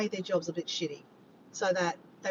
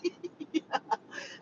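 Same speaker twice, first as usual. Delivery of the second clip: high, softer trailing giggle; sheepish laugh; 8 semitones higher, 5 dB quieter than usual. Real, not acted. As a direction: giggle after an apology. Laughing after apologising.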